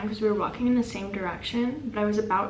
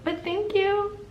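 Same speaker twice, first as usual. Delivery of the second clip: high-pitched polite thanks; polite brush-off; 10 semitones higher, slower than usual. Retold or imitated. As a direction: tack on a sweet, high thanks. Retold awkward polite brush-off.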